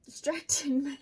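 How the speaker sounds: high, loud mock scold; scolding pets. Real, not acted. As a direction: tell off the cats. Scolding her cats.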